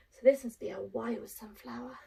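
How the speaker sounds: usual speaking voice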